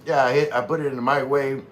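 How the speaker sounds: normal talking voice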